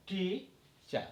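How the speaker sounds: high, stretched টি, then চা dropped after a pause; syllable-by-syllable demo with a big টি; stress on টি. Shown as a demonstration. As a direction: lean on টি, let চা fall away separately. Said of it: "বড় উচ্চারণ হবে".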